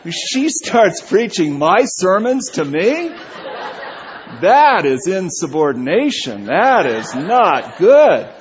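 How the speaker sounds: slow and loud, hammed-up disapproval; mock outrage. Real, not acted. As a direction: lay down the law, tongue in cheek. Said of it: "I hate that".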